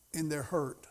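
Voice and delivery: normal talking voice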